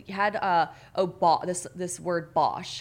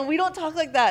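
imitated boyfriend protest; 9 semitones higher, 4 dB louder than usual. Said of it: "he's like".

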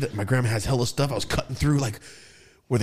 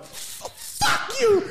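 angry outburst; much slower than usual. Retold or imitated. Kid swearing at his dad, high explosive outburst. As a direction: reenact it explosively. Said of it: "That's how I felt".

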